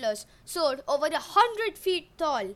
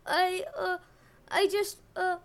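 terrified stammer; 5 semitones higher than usual. Acted. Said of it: "his knees were jelly".

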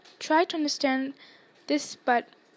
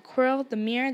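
usual speaking voice

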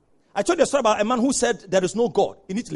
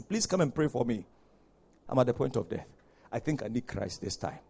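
softer, lower plea of a dying man; retold dying plea; 7 semitones lower, 8 dB quieter than usual. Professor on his deathbed asking for prayer, subdued. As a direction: retell it low and subdued, as a deathbed request. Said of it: "when he was about to die".

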